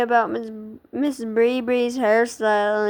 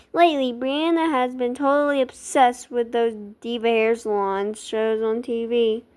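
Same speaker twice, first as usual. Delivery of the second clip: plain reading voice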